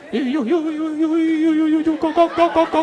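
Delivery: high, rapid-fire stutter; stammering thief. Retold or imitated. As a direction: get stuck repeating the syllable, high-pitched. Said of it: "who stammers".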